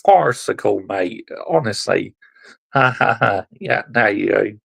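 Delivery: usual speaking voice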